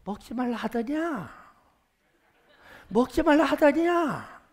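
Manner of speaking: slow, drawn-out questioning, rising in pitch; serpent's taunting question. Acted. Serpent questioning Eve about forbidden fruit, slow taunt. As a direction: stretch it out like the tempting serpent. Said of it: "뱀이".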